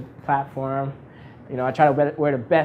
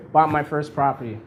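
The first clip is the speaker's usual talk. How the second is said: ordinary delivery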